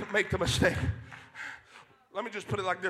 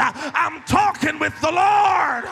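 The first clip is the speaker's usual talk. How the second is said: loud, rising pulpit preaching; fiery sermon voice, slowing on the last lines; full-force preaching; 3 semitones higher, 7 dB louder than usual. Acted. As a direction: preach it to the back row.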